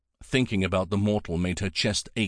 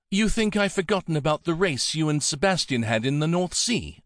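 a lightly voiced younger woman, higher, brisk; Melise's voice; 7 semitones higher than usual. A narrator's reading. Woman reassuring her friend over their link, a bit higher and confident. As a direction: lift the pitch for the woman and keep it confident.

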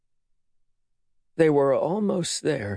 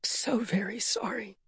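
unvoiced whisper; 10 semitones higher, 6 dB quieter than usual. A narrator's reading. Mother whispering sorry at her daughter's side. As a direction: breathe the apology, no voice. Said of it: "Rhoda whispered".

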